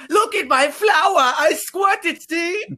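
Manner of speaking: very high, slow silly clown voice; goofy clown. Retold or imitated. Clown showing off his flower at the hospital, very high silly voice. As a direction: squeak like a dopey children's clown. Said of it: "he's like".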